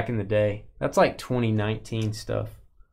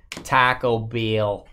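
"Taco Bell" in an exaggerated Canadian accent, vowels stretched slow; drawn-out mock-Canadian "Taco Bell"; much slower than usual. Shown as a demonstration. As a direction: say "Taco Bell" slowly as a teasing fake Canadian. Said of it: "That's how they say it".

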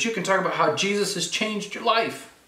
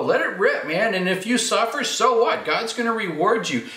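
higher, rapid-fire urging; preacher acting out a suddenly bold Peter; fired-up exhortation; 6 semitones higher than usual. Peter urging believers to speak up and let it rip, fired up.

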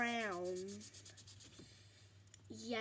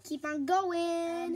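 slow drawn-out urging; 6 semitones higher, 9 dB louder, much slower than usual. Real, not acted. Urging herself on while drawing.

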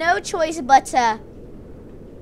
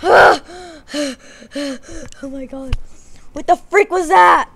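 astonished gasp; 6 dB louder than usual. Real, not acted. Narrator stunned by what just happened on screen, gasping amazement. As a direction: react in total disbelief. Reacting to surprise rescue.

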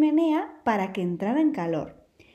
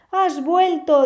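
higher, louder happy surprise; glad surprise; 6 semitones higher, 6 dB louder, much slower than usual. Acted. Wolf finding the lamb home, glad surprise. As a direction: greet her with delighted surprise. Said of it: "dijo el lobo sonriendo".

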